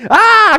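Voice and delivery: high, loud acted shriek; hammed-up frightened scream; mock scream of fear. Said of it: "se pusieron a gritar".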